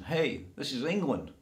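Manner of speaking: usual speaking voice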